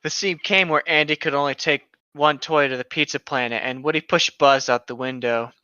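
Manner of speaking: ordinary talk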